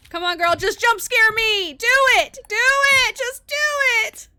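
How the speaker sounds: loud, high nervous yelling; scared horror game streamer. Real, not acted. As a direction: shout to get the scare over with. Yelling at the monster.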